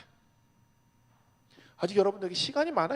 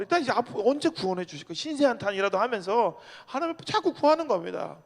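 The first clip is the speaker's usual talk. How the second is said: ordinary talk, nothing marked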